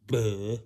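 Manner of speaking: loud imitated "blah" noise; booming, drawn-out silly blurt; Aunt Tam. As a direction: mimic the aunt's blurted blah. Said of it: "And she goes like this".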